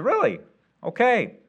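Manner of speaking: high-pitched, taken-aback reply; retold surprise. Pastor retelling his reply to the salesman, surprised. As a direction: pitch jumps up, humouring a strange claim.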